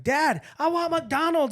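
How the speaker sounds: put-on high, drawn-out child's plea; whining kid. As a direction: imitate a kid pestering dad. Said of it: "then Gabby was like".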